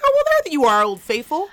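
high, loud fake delight at his return; mock surprise. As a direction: act thrilled he's back again. Greeting the returning old flame.

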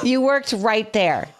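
each word spelled out slowly; slow and pointed. Retold or imitated. Wife pressing mother-in-law on the phone, slow pointed words. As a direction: lay it out slowly like to a child.

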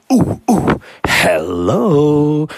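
high hoots, then a long hello; testing the acoustics. Retold or imitated. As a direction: hoot and sing out to hear the echo. Testing the wall's echo.

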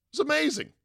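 excited high exclamation; gushing. Real, not acted. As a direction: burst up high with enthusiasm. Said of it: "gushing about old DirecTV".

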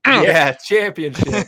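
slow, loud cheer of championship; loud drawn-out call. Pretend. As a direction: boom it out slowly like a chant. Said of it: "We're gonna say it for you".

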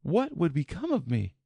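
put-on high, light girlish voice; young girl voice. Alice thinking aloud.

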